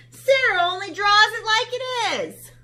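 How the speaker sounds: high, loud, matter-of-fact; animals. Acted. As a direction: defend Sarah brightly.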